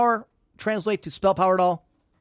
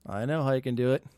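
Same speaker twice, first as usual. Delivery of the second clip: usual speaking voice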